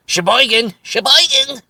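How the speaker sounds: high, squawky Jerry Lewis yelp; goofy nasal comic shout of the town name; hammed-up zany comedian cry; Jerry Lewis impression. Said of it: "Jerry Lewis would be known to say".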